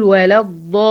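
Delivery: "ولا الضالين" read with no push on the doubled lam; "الضالين" said flat, with no stress on the doubled letter; without stress. Shown as a demonstration. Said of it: "لو انا ما عملتش النبر ده اقول ايه". ("ولا الضالين" من غير نبر على اللام المشددة)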